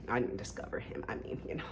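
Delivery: soft whispered correction; quiet whispered self-correction; whispered aside. Real, not acted.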